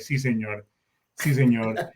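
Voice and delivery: low, grudging kid's reply, imitated; dutiful yes with an eye-roll; eye-rolling obedience. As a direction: imitate a kid humouring his dad. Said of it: "Rolling his eyes".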